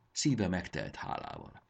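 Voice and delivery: ordinary delivery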